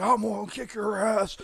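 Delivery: mock angry threat. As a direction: imitate a biker spitting threats in your face. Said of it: "he's all spitting in my face".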